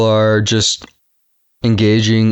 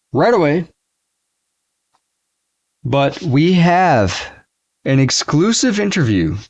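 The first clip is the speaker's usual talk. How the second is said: showy TV host voice; TV announcer; 5 semitones higher than usual. Acted. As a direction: sell it like a hyped-up TV host. Hosts a mock TV exclusive.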